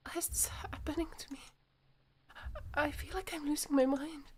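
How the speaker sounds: high, breathy distress; whispered panic. Acted. Yuri feeling she is losing her mind, panicked whisper. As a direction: whisper it as if unravelling.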